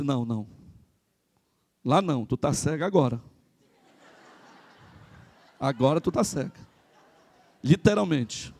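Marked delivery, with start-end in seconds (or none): 0.0-6.5 much lower, softer dry reply; a weary mother stating the hard truth; resigned mother. Retold or imitated. Mother answers her daughter. (mãe respondendo à filha, grave e baixo)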